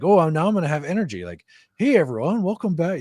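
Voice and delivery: high, loud energetic YouTube intro; hyped YouTuber voice. Shown as a demonstration. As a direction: pump it up like a vlogger. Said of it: "that kinda energy".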